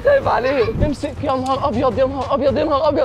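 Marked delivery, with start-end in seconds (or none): 0.0-0.7 much higher, alarmed; the worry jumps up into a yelp; high anxious cry. Real, not acted. Flying the kite, he yelps his worry much higher.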